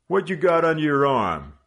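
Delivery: high, loud sudden challenge; abrupt demand. Acted. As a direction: snap the question at him. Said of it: "the leader demanded abruptly".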